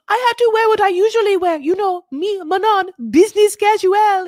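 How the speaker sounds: loud, very high mock French diva; exaggerated French-accented protest at top pitch; Manon in bad French accent. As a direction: whine it shrilly in a hammy French accent. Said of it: "My French accent is, you know, leaves a lot to be desired".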